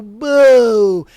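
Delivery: loud booing. Real, not acted. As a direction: boo her off the stage. Booing Casey.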